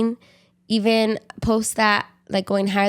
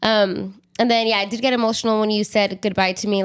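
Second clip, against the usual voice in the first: normal talking voice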